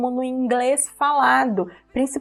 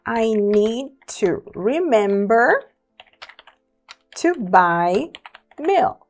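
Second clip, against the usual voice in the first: very slowly, each word clear: "I need to remember"; frase de exemplo bem devagar; slow example sentence; slower than usual. Shown as a demonstration. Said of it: "vou colocar duas frases parecidas".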